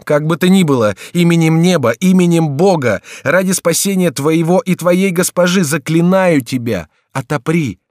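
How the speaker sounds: high, loud entreaty; impassioned shouted plea; crying out. A narrator's reading. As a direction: implore at the top of the voice. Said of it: "вскричал Генрих".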